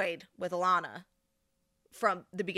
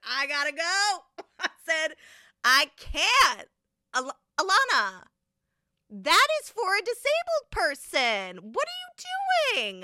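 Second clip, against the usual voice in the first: much higher, louder, slow shriek; shrieking disbelief; 10 semitones higher, 9 dB louder, slower than usual. Retold or imitated. To Sarah about the wheelchair, shrieking in outrage. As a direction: scream it in outrage.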